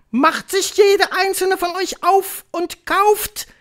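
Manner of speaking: high, loud, slow screech; commanding witch. A narrator's reading. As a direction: draw out the order for suspense. Witch commanding her followers. (herrische Hexe, gedehnt und schrill)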